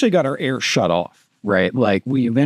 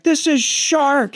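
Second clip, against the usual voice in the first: calling out; 13 semitones higher, much slower than usual. Retold or imitated. Calling to pilot over noise.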